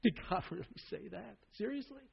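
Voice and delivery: soft, with a small laugh mid-question; slight laughter. Shown as a demonstration. Preacher shows the serpent's slight laugh, mocking softly. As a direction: chuckle through it condescendingly. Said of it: "this slight laughter".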